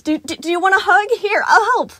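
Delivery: high, loud pleading; begging. Acted. Animatronic begging the crying boy to stop, high and loud. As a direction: beg him frantically not to cry. Animatronic offers panicked hug.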